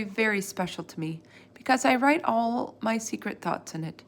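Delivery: ordinary delivery, nothing marked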